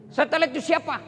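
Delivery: eager follower pressing the Prophet; eager questioner. Acted. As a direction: raise the voice with each ask. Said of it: "repeated question".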